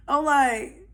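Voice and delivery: ordinary delivery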